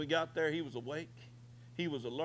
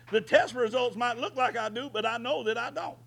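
high, loud preacher's call, pushing hard; fiery preaching; 7 semitones higher, 4 dB louder than usual. Real, not acted. Preacher rousing the congregation to shout, fiery high call. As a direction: rouse the church, voice raised high.

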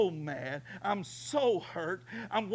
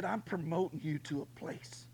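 God's gentle voice; 7 dB quieter than usual. Retold or imitated. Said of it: "He said".

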